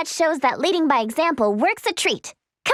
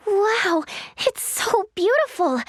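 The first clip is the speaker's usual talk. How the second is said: higher, slow admiration; awestruck wonder; 4 semitones higher, slower than usual. Acted. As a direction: marvel at the beauty. Awed by a beautiful city.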